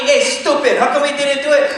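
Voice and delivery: high, loud nagging spouse; mock spouse. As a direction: scold the partner who missed out. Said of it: "your spouse is elbowing you".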